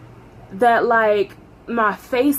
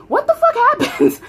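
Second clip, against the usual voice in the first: very high, loud squeal of disbelief; bewildered outburst; 5 dB louder than usual. Retold or imitated. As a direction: squeak it out, half laughing. Acts out own bewilderment after call.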